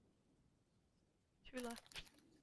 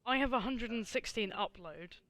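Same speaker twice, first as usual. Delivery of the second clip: ordinary talk, nothing marked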